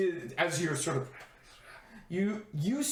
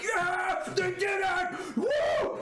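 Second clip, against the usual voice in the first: excited cheer; 11 semitones higher, much slower than usual. Acted. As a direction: celebrate like a rowdy guard. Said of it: "they seem to be fairly, like, excited".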